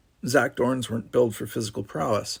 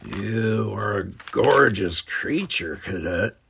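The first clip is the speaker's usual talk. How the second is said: slow, smooth seductive voice; smooth flirt; slower than usual. Acted. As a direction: purr it slowly like a charmer.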